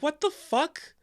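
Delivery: squeaky high disbelieving what the fuck; stunned, jump up high on it; appalled viewer outcry; shocked outburst. Retold or imitated. Viewer reacting to shocking ending.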